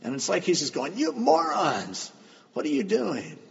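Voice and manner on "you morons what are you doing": retold exasperation. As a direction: imitate someone upset, pitch jumping up and falling. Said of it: "He's mad. He's upset".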